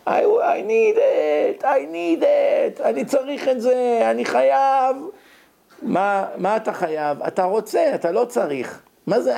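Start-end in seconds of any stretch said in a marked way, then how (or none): 0.1-5.0 put-on high, drawn-out whining child; child crying that he needs it; whiny child's demand. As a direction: beg like a kid who wants candy. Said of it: "הוא מתחיל לצעוק, בוכה".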